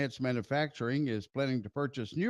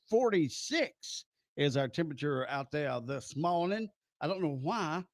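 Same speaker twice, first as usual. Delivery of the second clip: usual speaking voice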